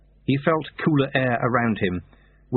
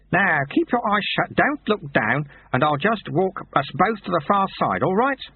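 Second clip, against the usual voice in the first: lightly voiced kindly old sweeper, higher; calm the boy gently; old monk, reassuring; 8 semitones higher than usual. Old sweeper reassures the dangling boy, lightly voiced kindly. Lutze guiding boy across bridge.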